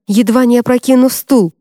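ordinary delivery, nothing marked

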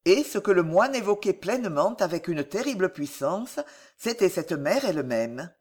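ordinary delivery

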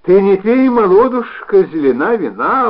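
loud, high theatrical recitation; declaiming. Acted. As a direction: recite it grandly, as on stage. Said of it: "продекламировала".

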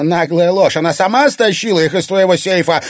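high, loud, angry denial; vicious roar. A narrator's reading. Irina denying the theft to Egor, vicious roar. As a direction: hurl the accusation back loudly. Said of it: "злобно взревела Ирина".

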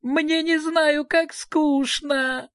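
put-on high, long-drawn childish wail; little girl's acted howl of boredom; bawling. A narrator's reading. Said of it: "снова разревелась".

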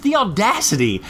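high-pitched exasperated rant; incredulous indignation. Real, not acted. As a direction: protest in high-voiced disbelief. Sarcastic rant about blocking the row.

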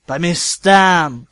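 slow, loud stern order; stern command. Acted. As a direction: bark the order at students. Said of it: "скомандовал профессор Снейп".

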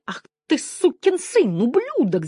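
high, drawn-out furious screech; shrieking. A narrator's reading. Janine curses the lieutenant blocking her, shrieking. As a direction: screech the insults, stretching them out high. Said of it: "завизжала Жанин".

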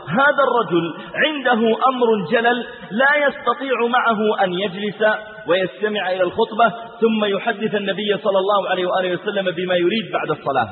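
ordinary talk

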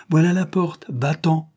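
curt angry send-off; brusque dismissal. Acted. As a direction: show him the door, high and loud. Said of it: "Et brusquement".

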